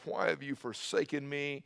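normal talking voice

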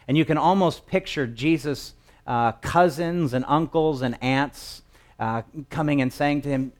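normal talking voice

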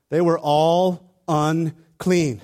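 preacher stressing the point to the congregation; slow emphasis. Real, not acted. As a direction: lean on every word. Preacher driving home the point.

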